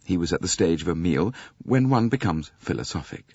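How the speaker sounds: plain reading voice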